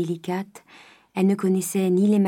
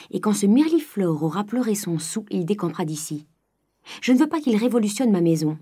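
ordinary delivery, nothing marked

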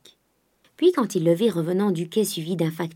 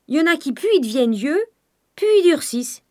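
excited; 10 semitones higher, 6 dB louder than usual. A narrator's reading. Servant rising to a point.

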